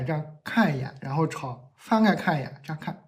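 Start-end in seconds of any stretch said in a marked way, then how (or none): none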